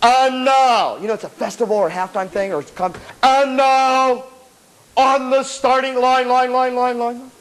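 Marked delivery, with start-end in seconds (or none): 0.0-1.0 higher, stretched stadium call; marching band announcer. Retold or imitated. Announcer to a band standing on the field, booming. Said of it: "that's the loudest one". 3.2-7.3 slow, higher stadium voice with mimicked echo; marching band announcer. Announcer introducing a band at halftime, booming echo. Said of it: "standing there and they go".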